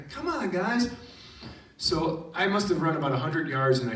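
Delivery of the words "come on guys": high, eager coaxing call; playful high-pitched beckoning; excited calling. Retold or imitated. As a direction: urge friends to join the fun. Calling students to run along.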